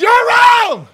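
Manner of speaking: high, loud angry shout; retold angry outburst. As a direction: bark it at him like an offended church elder. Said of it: "he got so upset".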